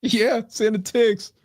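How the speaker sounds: drawn-out mock rapper voice; slow rapper impression. Slow mocking imitation.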